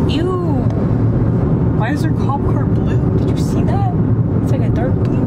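loud upbeat vlog voice; vlog presenter. Acted. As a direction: talk to the viewers. Vlog intro to the camera.

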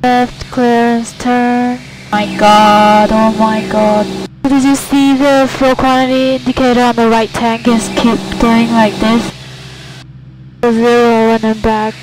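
ordinary talk

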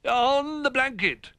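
high, loud, drawn-out toy voices; eager chorus. A narrator's reading. Toys answering Old Bear in a hurry, eager high chorus. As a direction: sing out an eager answer together. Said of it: "chorused the toys quickly".

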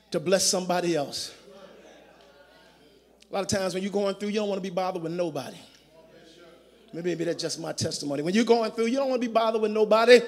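hushed, low preaching voice with quick asides; quiet reflective preaching. Acted. Pastor quietly asking the congregation to reflect. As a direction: draw the congregation in close, speaking softly.

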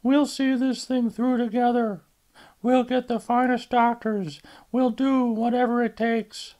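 a mother pleading with her daughter; mother's voice. Acted.

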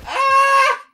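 piercingly high, loud shriek; startled scream. Real, not acted. Player startled mid-sentence in a game, shrieking. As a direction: shriek as if suddenly jumped.

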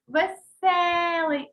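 веселий with a long, high «е»; stressed middle syllable. Shown as a demonstration. Teacher puts the vowel under stress, веселий with drawn-out «е». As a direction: slowly stretch «се» in веселий. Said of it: "Ставимо під наголосом".

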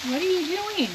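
high mock-indignant question; playful scolding. Real, not acted. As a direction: tease her for meddling. Mock scolding over grill temperature.

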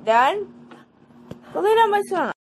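sharp order to lie down; shouting at the dog. Real, not acted. Girl ordering her dog to lie down, loud shout.

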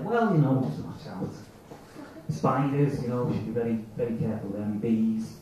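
put-on low, lightly Italian-accented inner voice; trailing warnings with a hint of Italian; little Italian accent. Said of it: "in a little Italian accent".

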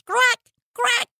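very high, loud caws; two piercing crow calls; grateful crow cry. A narrator's reading. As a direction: squawk thanks like a bird. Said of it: "gritó como expresando agradecimiento".

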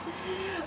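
short high giggle. Real, not acted. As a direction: laugh at your own bit. Laughs at own impression.

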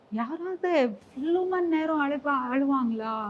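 nephew's bewildered question. Retold or imitated. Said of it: "he's like".

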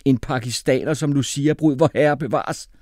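loud, high-pitched indignation; shouted objection. A narrator's reading. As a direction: protest in outraged disbelief. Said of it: "råbte Jeppe".

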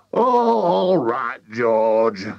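exasperated father. A narrator's reading. Mister Cranky to George, drawn-out exasperation.

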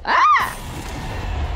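very high, thin shriek; high scream. Real, not acted. Player taunted by an opponent, very high shriek. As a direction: let out a squealing scream.

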